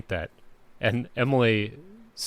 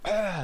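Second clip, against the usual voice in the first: higher mock sneeze wind-up; dad sneezing; 10 semitones higher than usual. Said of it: "and then Dad sneezing".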